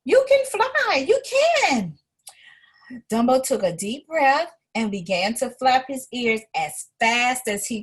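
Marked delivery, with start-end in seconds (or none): 0.0-2.0 much higher and louder, urging; high loud mouse cry of faith; urgent cheering cry. A narrator's reading. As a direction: shout belief into him as they fall. Timothy cries encouragement mid-fall.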